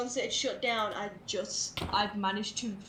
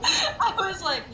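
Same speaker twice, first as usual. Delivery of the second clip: laughing; 9 semitones higher, 7 dB louder, slower than usual. Real, not acted.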